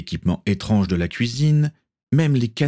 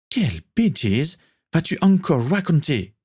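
indignant retort; 9 semitones higher than usual. A narrator's reading. Sister quarrels with brother.

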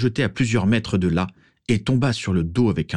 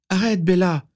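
high-pitched urgent shout; crying out; 11 semitones higher than usual. A narrator's reading. Régulus begs Bellatrix to stop torturing his brother, high cry. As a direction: call out to stop her, voice leaping up. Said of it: "cria Régulus".

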